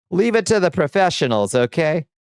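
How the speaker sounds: high-pitched dismissive gatekeeper; mock scolding. Gatekeeper turning away an amateur elf, mock scolding.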